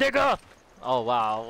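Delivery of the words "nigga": aggressive. Pretend. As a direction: bark it out like a boss. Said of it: "that kinda sounded aggressive".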